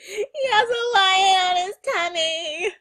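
loud, high, slow squeal; excited shout. Real, not acted. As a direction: yell it out in excitement. Excited reaction to scary lion.

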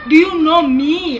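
loud, slow, drawn-out question; defiant challenge. Acted. Maid challenging the boss who fired her, loudly defiant.

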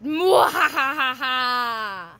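slow booming evil cackle; evil villain laugh. Acted. Villain taunting in game.

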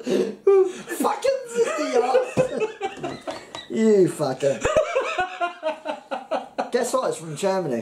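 laughing through the words; giggling, barely holding it together. Real, not acted. Laughing at crude joke.

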